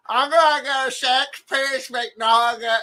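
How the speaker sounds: high, slow, drawn-out Brett order. Retold or imitated. Ordering at the drive-thru window as Brett, slow and high. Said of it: "order the food as Brett".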